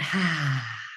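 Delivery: long audible sighing exhale; relaxed breathy out-breath. Shown as a demonstration. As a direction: let the breath all out on a sigh. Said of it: "breathing all the way out".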